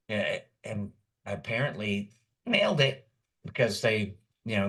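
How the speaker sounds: ordinary delivery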